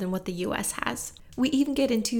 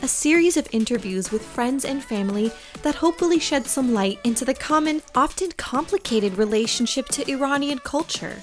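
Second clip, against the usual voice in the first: raised, louder scripted series introduction; podcast intro read; 3 semitones higher, 7 dB louder than usual. Acted. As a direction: present the show brightly over theme music, pitch lifted.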